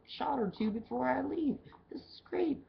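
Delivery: high, hopeful little cheer; hopeful excitement. Retold or imitated. Bartender hoping to leave early for a drink, high giddy hope. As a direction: sound giddy about leaving early. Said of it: "I was like".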